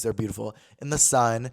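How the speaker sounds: ordinary delivery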